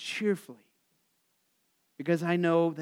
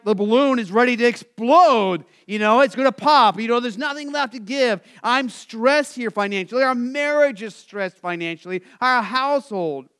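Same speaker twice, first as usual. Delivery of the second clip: higher, louder voice of a stressed listener; mock worried congregant, higher and louder; 6 semitones higher, 7 dB louder than usual. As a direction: voice the worried churchgoer, a bit raised. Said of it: "you're like".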